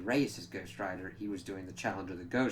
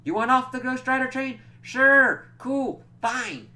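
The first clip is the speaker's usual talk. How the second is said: high, loud fed-up mock voice; exasperated snap; 13 dB louder than usual. Johnny fed up with Danny, in a high loud exasperated voice. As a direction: toss off each word in annoyance.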